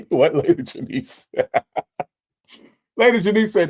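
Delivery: high, loud words through real laughter; laughing in disbelief. Real, not acted. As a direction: crack up while introducing the comment. Cracks up at viewer's comment.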